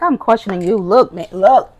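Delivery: pleading with God to explain; retold demand to God. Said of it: "I was like".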